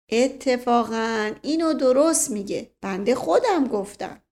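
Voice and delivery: ordinary delivery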